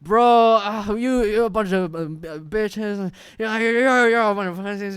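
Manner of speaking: put-on loud, high drunken insults, slow on the stumble; retold drunk yelling. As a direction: hurl slurred insults at a rival group. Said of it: "he just starts yelling at them".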